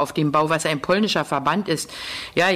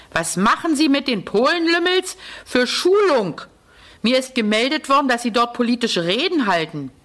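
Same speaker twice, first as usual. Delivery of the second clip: roaring officer; 6 semitones higher than usual. A narrator's reading. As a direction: explode at him from nowhere. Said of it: "Plötzlich brüllt er".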